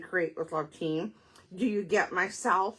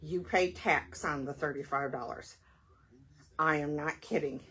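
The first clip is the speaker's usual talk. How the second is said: ordinary delivery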